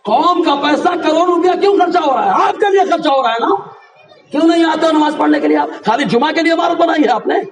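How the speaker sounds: fiery preacher's rebuke; preaching. Acted. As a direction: scold the congregation with full force. Scolding absent worshippers.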